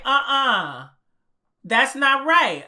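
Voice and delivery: retold disbelief. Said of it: "And I'm like".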